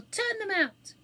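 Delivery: high, loud demand; indignant call. A narrator's reading. Audience wanting the children thrown out, indignant call. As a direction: call out from an annoyed crowd.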